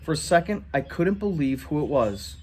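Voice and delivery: ordinary delivery, nothing marked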